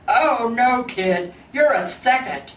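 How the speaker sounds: blunt bear delivering bad news loudly; loud gruff old bear; loud Big Bear.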